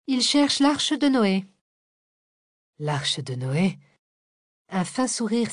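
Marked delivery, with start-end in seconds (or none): 0.1-1.4 high, slow and detached; slow, lofty indifference. Acted. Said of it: "avec détachement". 2.8-3.8 low, slow wondering question; slow musing echo; puzzled echo. Acted. Parella echoes the words, puzzled.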